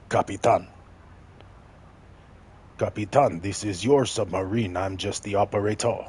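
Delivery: lightly done, bad Russian accent; a hint of a Russian crewman, hammed-up Russian accent; Pavel Russian accent. Streamer imitating Russian crewmate for chat, weak mock Russian accent. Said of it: "that's a horrible Russian impression".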